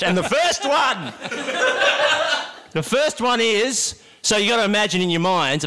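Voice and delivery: ordinary talk, nothing marked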